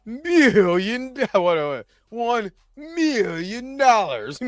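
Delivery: slow, high, drawn-out announcement; hammed-up big-money pitch; grand money offer. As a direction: stretch out MILLION to tempt them. Acts studio offering huge check.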